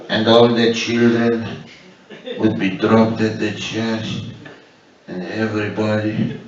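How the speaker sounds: slow, soft, hushed papal confession; mock-solemn old priest, quiet and slow; Pope impression. Comic voicing the Pope's confession, slow and soft. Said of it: "When he said".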